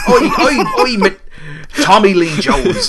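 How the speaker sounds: high, laughing shouted call; laughing mock shout. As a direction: yell it out, cracking up. Calling out as a film character.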